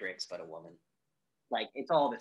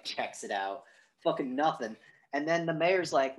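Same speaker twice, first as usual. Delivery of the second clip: ordinary talk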